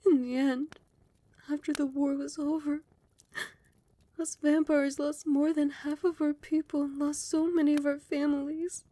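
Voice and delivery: high, loud, distressed confession; anguished recollection. Acted. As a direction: pour out grief over the war. Anguished recall of war losses.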